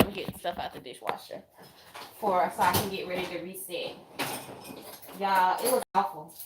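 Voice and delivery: ordinary talk, nothing marked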